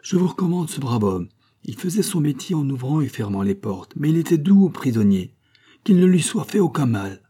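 earnest, projected plea for mercy; public appeal. A narrator's reading. Pleads to crowd for jailer.

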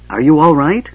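anxious. A narrator's reading. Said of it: "Goldmoon asked anxiously".

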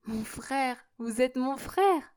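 moved astonishment. A narrator's reading. Sister to her newfound brother, in tender amazement. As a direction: take it in slowly, amazed and hushed.

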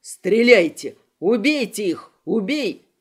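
loud barked commands; furious shout. Acted. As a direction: yell the order to fire. Kristof orders the shooting.

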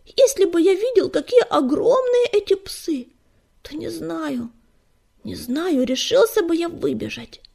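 high, agitated kitten voice; pitched well up with remembered fright; frightened youngster. A narrator's reading. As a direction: blurt it out like a scared little one. Frightened kitten confesses his fear.

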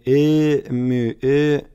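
endlessly stretched hesitation sound; drawn-out mumbling hem. A narrator's reading. Narrator mimics the teacher padding each phrase, mumbled hem. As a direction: imitate the teacher's chewed-out hemming. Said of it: "мямлил и каждую фразу".

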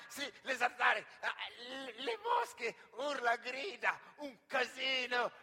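loud, rushing excited listing. Acted. Homesick comic shouting his visions of home, excited.

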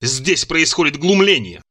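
plain reading voice

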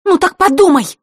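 high, loud, cross retort; sharp angry comeback; angrily. A narrator's reading. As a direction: throw it back at him. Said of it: "рассерженно добавила Василиса".

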